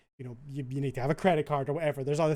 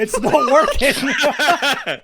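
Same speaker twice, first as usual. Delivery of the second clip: laughing; 8 semitones higher, 10 dB louder, much slower than usual. Real, not acted. Frustrated, laughing at tech failure.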